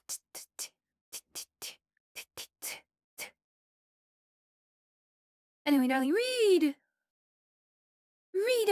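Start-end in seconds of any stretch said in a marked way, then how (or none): 0.1-3.3 whispered beat. Real, not acted. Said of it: "Gotta jazz it up a little bit".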